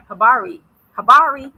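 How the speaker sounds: loud, heavily American pronunciation; American accent. Shown as a demonstration. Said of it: "with an American accent".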